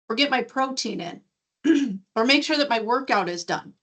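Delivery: heated rant. Retold or imitated. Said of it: "she's rolling".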